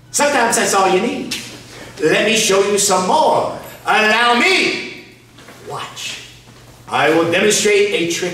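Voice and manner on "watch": a hushed whispered cue to watch; soft suspenseful whisper. Acted. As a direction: whisper it like a stage secret. Magician builds suspense before trick.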